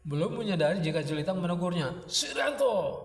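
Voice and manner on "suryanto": high-pitched shout, falling at the end; yelled name. A narrator's reading. Jelitang calling gaping Suryanto back to attention, high yell. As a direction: yell to wake a daydreamer. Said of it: "teriak Jelitang".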